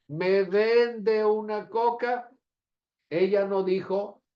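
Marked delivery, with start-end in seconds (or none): 0.1-2.3 high, slow, drawn-out request; singled-out words, pitched up like a child customer; child at the store. Acted. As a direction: act out a little girl buying a drink. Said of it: "es una niña".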